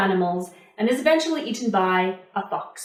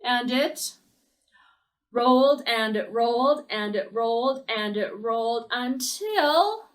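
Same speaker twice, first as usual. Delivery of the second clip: ordinary delivery, nothing marked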